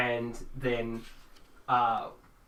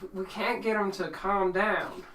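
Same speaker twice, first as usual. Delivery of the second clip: higher-pitched, hesitant plea; worried game character; 7 semitones higher than usual. Acted. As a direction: voice a flustered underling, pitched up. Game master voices a worried character.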